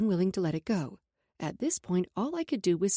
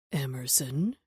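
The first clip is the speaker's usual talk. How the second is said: commanding tone; 8 semitones lower, slower than usual. A narrator's reading. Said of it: "he said in a commanding tone".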